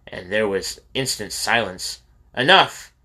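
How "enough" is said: a loud, high, furious bark of a command; angry shout. A narrator's reading. Camp master silencing the uproar of barracks leaders, angry shout. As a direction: slam the room into silence with one loud word. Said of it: "Mister Yamajai was angry at the uproar".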